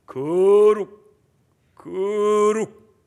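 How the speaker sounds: slow, loud drawn-out 거룩 like a crow's caw; mock holy drawl. Preacher mocking a pompous holy voice, slow loud drawl. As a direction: stretch out 거룩 slowly and loudly, mocking pious tone. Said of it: "때까우 소리 하듯이".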